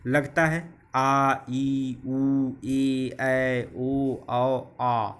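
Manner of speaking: each long vowel "आ", "ई", "ऊ" held slowly and clearly; drawn-out long vowels. Shown as a demonstration. Teacher slowly sounding out long vowels for students. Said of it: "दो मात्रा का जो चिन्ह है".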